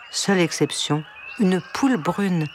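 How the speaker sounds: ordinary delivery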